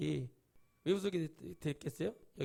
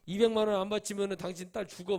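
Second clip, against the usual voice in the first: mock threat; 4 semitones higher, 7 dB louder than usual. Fake pastor threatening a believer.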